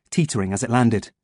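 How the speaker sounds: ordinary delivery, nothing marked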